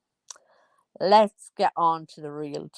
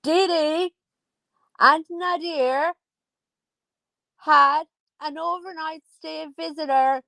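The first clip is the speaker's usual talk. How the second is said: Dee Dee pitched up high, each name drawn out; announced again, higher; 9 semitones higher than usual. Shown as a demonstration. Telling viewers again for the people in the back, much higher. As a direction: repeat it way up high for emphasis. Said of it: "for the people in the back".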